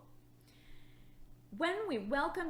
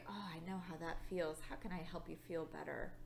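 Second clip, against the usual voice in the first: softer, quick, gentle reassurance; empathetic comfort; 6 semitones lower, 7 dB quieter, faster than usual. Shown as a demonstration. Teacher modelling kind words for the children: soft, quick empathetic comfort. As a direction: comfort a friend who stepped on a Lego. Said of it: "I can be empathetic".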